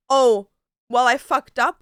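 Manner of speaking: loud, imitating a careless excuse; mock casual admission. Boyfriend brushing off his mistake to his girlfriend, loud mock admission.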